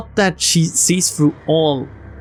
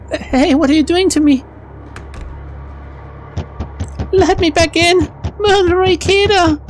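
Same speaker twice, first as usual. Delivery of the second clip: panicked shouting. Acted. Neneko locked outside begging Ikeda, high panicked shouting. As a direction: scream and bang on the door in a panic.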